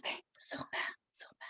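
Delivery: soft whispered answer; hushed. Real, not acted. Storyteller lowering her voice for her sick daughter, whispering. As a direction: keep it low so a sick daughter can sleep. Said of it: "je suis obligée de parler tout".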